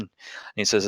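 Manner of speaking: ordinary talk, nothing marked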